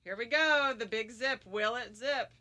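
tense hush. Real, not acted. Tense zip-up.

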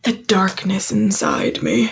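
slow, mock-dramatic brooding. Podcaster mocking the characters' darkness line, mock brooding. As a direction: draw it out like a melodramatic confession.

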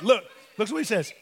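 ordinary talk

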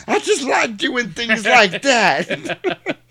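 high, very slow, coy voice; high slow coy squeak; high mock simper. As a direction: say it coyly and drawn out. Luther voiced.

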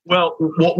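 normal talking voice

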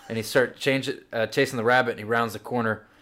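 usual speaking voice

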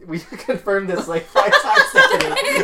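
high pitched laughing exasperation. Real, not acted. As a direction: laugh through the words. Laughing at friend's repeat.

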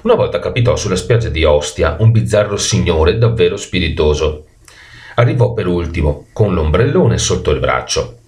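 plain reading voice

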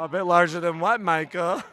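loud, playfully affronted challenge; teasing, outraged question; mock indignation. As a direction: pretend to be offended, loudly. Mock offence at a cheeky remark.

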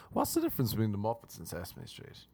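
usual speaking voice

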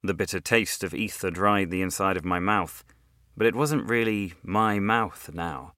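usual speaking voice

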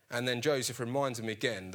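ordinary delivery

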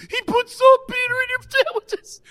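very high, squealing through laughter; hammed-up breathless laughing confession; howling with laughter. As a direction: re-enact helpless giggling while trying to get the words out. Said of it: "I'm bleeding out of my eyes laughing".

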